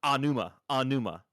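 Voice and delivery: Anuma said with the O dropped, ah-NOO-mah; mock the common wrong Anuma; people's lazy Anuma, no O sound; imitating mispronunciation. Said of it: "a lot of people say".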